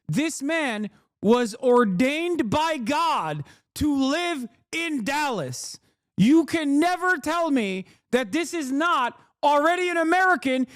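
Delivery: high, slow, emphatic proclamation; mock-grand declamation. Streamer declaring this man a born American, mock-grand declamation. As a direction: proclaim it like an impassioned orator, drawing out phrases. Sustained mock-solemn rant.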